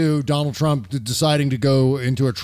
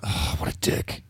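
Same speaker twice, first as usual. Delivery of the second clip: whispered disgust; 8 dB quieter than usual. Real, not acted. Disgusted aside after tweet.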